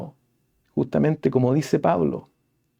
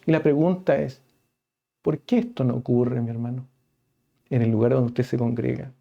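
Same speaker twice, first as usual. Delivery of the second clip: ordinary talk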